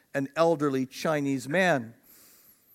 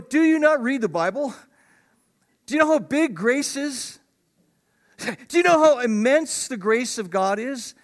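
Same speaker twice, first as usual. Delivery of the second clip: indignant preaching; 7 semitones higher, 5 dB louder than usual. Retold or imitated. Pastor answers critics of deathbed conversions.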